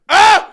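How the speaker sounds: a sudden loud, high "Ah!" yell. Retold or imitated. Wayne Lee yelling in classroom.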